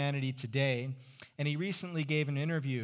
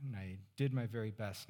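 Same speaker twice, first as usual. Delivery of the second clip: ordinary talk, nothing marked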